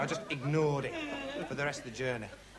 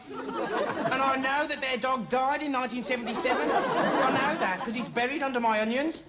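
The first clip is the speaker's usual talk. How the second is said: higher, drawn-out, worked-up insistence; indignant certainty; 7 semitones higher than usual. Acted. Suitor exposing the lie about the dog, indignant. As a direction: build the outrage slowly, voice raised in pitch.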